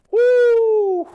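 loud, very high woo to release stress; shouted woo to calm down; calming exhale whoop. Retold or imitated. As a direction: blow off frustration with a big woo. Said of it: "I needed to breathe".

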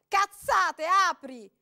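very loud angry order; man yelling to open up; furious shout. A narrator's reading. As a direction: spit it at her.

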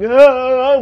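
high, loud strangled moan; imitated choking cry. Teller mimics the wife being choked, high strangled cry.

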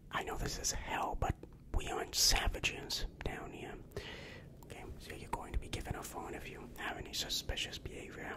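ordinary talk

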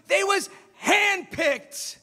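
slow, high, emphatic shout; shouted revelation. Retold or imitated. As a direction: shout the hard truth word by word. Acted quarrel, coach's climactic reveal.

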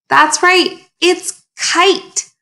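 mock delighted teacher praising the class; excited. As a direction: gasp then a high, bright That's right. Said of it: "teacher reacts to answer".

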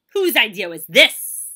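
very loud, slow question; thundering demand. A narrator's reading. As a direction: boom it out sternly. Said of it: "he thundered".

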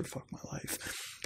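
slow, breathy unvoiced whisper; defeated whisper. Real, not acted. Reader whispering in defeat at a silly joke. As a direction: whisper it like you give up. Reacting to Cookie Monster entry.

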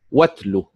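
واتل with the stress on the واو; stress on the waw. Shown as a demonstration. Teacher reading the verse word, stress on the واو. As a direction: lean on the opening واو of واتل. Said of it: "النبر على حركة الواو".

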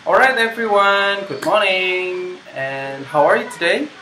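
teacher showing how to open the class; classroom greeting demo. Shown as a demonstration. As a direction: greet a class of small children slowly and brightly.

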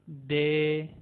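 "दे" with a soft dental द. Shown as a demonstration. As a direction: say "दे" soft, not hard. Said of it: "दे hard sound नहीं". ("दे" नरम आवाज़ में)

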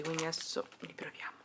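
soft unvoiced whisper. Real, not acted. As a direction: whisper it so nobody wakes. Whispering to camera, quiet room.

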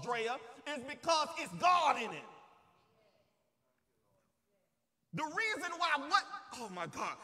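preaching at full force. Real, not acted. Preacher urging the church never to forget it is God, forceful preaching. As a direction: build to loud, insistent peaks.

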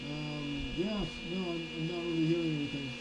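muffled behind respirator; speaking inside sealed mask; through a face mask. Real, not acted. Seen 2: Full-face respirator with cartridges worn over face throughout; mic outside.

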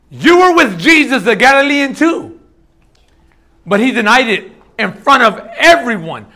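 proclaimed scripture; forceful scripture reading. Acted. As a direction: read the Bible aloud to the congregation with fire.

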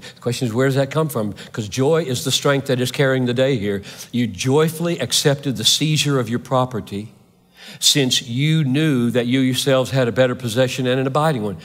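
ordinary delivery

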